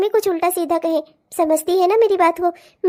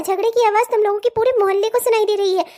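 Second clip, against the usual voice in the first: normal talking voice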